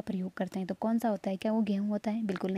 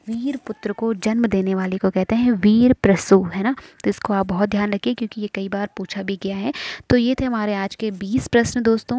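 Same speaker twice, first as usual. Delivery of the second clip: much louder, pressing the point home to the class; loud urging; 8 dB louder than usual. Real, not acted. Teacher urging exam students, loud and insistent. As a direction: raise the voice and push them to study now.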